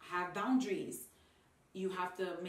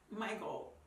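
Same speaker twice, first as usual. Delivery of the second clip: normal talking voice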